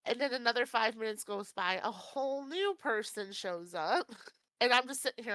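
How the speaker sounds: ordinary talk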